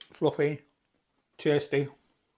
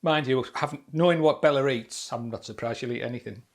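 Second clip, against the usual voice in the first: ordinary talk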